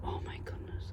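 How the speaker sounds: real hushed whisper of amazement; awed whisper. Real, not acted. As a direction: whisper it to yourself in wonder. Awed at an intact find.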